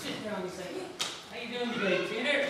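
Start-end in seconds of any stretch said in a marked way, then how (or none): 1.3-2.4 higher-pitched mock English accent. Rider greets Peter before the race, mock English accent. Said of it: "In his best English accent".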